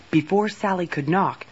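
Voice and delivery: usual speaking voice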